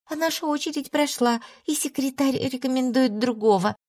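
a meek wife pleading; timid servant woman. A narrator's reading. Emilia voiced by narrator.

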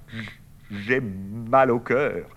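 slow, stretched-out queasy moan; nauseous groan. Acted. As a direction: drag out the words feeling sick. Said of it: "Bernard qui s'affaisse sur une chaise". (voix nauséeuse, très lente)

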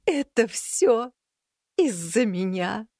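high, slow tearful cat; tearful wail. A narrator's reading. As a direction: sob it out, guilty and slow. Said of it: "заливающегося слезами собеседника". (плачущий кот, медленно)

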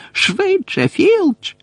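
very high-pitched shrieked order; panicked shriek. A narrator's reading. Umbridge urges Filch to hurry, shrieking high. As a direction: shriek it out high, without much extra volume. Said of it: "зарепетувала Амбридж".

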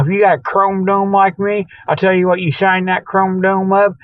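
ordinary talk, nothing marked

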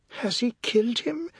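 high-pitched, calmer question; high anxious query; concerned wife. A narrator's reading. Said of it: "Her voice held pride and genuine concern".

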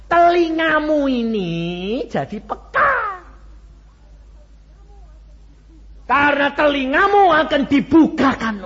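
loud, high testimony cry; preaching at full force. Acted. Preacher proclaiming his own practice to the congregation, full force.

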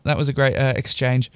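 ordinary delivery, nothing marked

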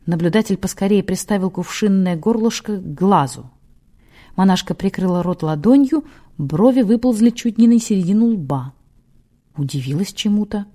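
usual speaking voice

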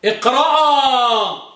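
اقرأ stretched very slowly; drawn-out command example. Shown as a demonstration. Teacher models the imperative اقرأ slowly. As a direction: say اقرأ long and slow as a model. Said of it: "قوما".

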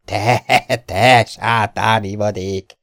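a hint of a gruff mock rebuke, slowed down; mock grumble. A narrator's reading. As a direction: growl it out like a fond insult between friends. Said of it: "dörmögte Viktor".

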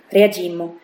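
ordinary delivery

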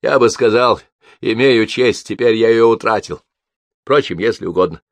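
wry and wounded; pained irony. A narrator's reading. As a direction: answer with a pained sneer at yourself. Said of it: "с болезненной усмешкой ответил".

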